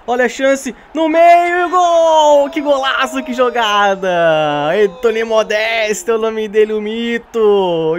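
screaming goal call, drawn out; explosive goal commentary. Real, not acted. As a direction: erupt into a wild goal celebration. Gamer celebrates goal on stream. (narração de gol explosiva)

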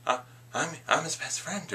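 high, soft mock-obsessed fan; Electro. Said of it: "Electro suddenly gets all obsessed".

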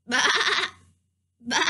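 short imitated sheep baas; sheep bleating. Storyteller leading kids to call the lost sheep, bleating. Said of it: "make the noise of a sheep".

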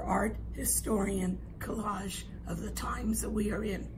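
hoarse, whispery voice; losing her voice, half whispering; raspy whisper. Real, not acted. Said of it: "I'm sorry I'm losing my voice".

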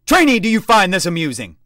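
loud, high screamed question; screaming demand. A narrator's reading. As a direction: scream it an inch from his nose. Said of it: "he demanded, screaming the question".

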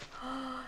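soft, quick gasp before a cry; startled gasp. Real, not acted. Player spotting carrots, startled gasp. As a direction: gasp at a lucky find.

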